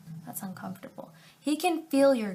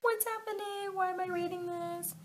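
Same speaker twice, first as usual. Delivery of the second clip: much higher, softer disbelief; higher incredulous aside; 9 semitones higher, 5 dB quieter than usual. Real, not acted. Reader reacting to the fanfic, much higher and disbelieving. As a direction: squeak out your disbelief. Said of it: "disbelieving aside".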